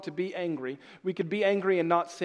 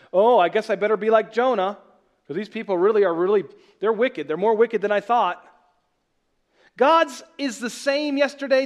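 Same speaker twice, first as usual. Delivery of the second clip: loud, raised preaching, slowing on key lines; preaching at full force; 6 semitones higher, 8 dB louder than usual. Acted. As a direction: hammer home that God's love never changes. Preacher drives point home.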